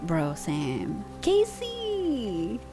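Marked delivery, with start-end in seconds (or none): none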